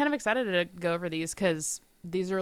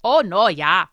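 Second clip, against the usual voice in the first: put-on Midwestern accent; 8 dB louder, slower than usual. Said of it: "I became Midwestern for a second there".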